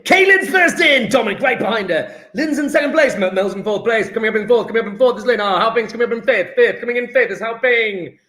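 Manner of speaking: quick, excited play-by-play; race commentary. Pretend. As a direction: call the finishers like a race commentator. Calls chat answers like a race.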